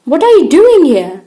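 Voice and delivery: indignant schoolgirl shouting at her enemy; angry demand. A narrator's reading. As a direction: confront the rival head-on. Sophie confronting her school rival.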